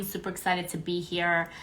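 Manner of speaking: ordinary delivery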